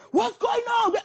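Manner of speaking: high, urgent mock shout; calling out in alarm. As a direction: imitate a crowd rushing out. Thugs rush out shouting.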